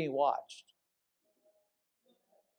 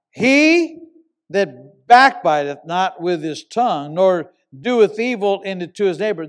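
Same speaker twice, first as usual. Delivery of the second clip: loud, slow, solemn reading of the psalm; scripture reading; 6 dB louder than usual. Acted. Preacher to his congregation, reading scripture loud and slow. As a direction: preach the verse out to the hall.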